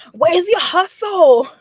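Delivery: loud, high, drawn-out challenge; Dominican hustler coming out. Retold or imitated. Speaker pushing the kid to start a business, loud hustler challenge. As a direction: demand it loudly, stretching the words. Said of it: "the Dominican and, and, and Laurentian and me comes out".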